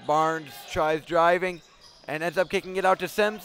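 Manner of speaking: ordinary talk, nothing marked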